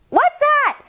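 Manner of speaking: put-on high, fast chatty little girl. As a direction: rattle it off like an excited kid. Decibella asking.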